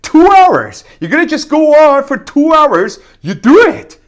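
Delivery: high, loud rallying cry; coaching shout. Real, not acted. Learner urging viewers to practise two hours, rallying shout.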